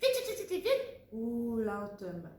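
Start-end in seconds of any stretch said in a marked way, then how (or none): 0.0-1.0 higher, rattled-off vite, vite, vite; mock fast. Shown as a demonstration. Teacher mimicking the child's rushed talking, higher. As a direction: imitate the rushed talk in a high quick voice. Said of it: "tu parlais vite".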